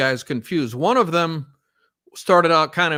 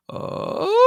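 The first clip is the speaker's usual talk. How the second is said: much higher breathy "Oh." sliding up; high warm-up sweep. Shown as a demonstration. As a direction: glide "Oh." up as high as it goes. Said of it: "go up as high as you can".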